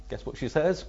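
ordinary delivery

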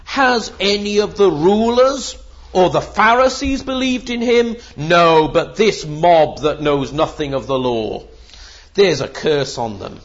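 loud, scornful Pharisee's retort; haughty rhetorical challenge falling into condemnation; intellectual snobbery. Acted. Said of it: "they appeal to intellectual snobbery".

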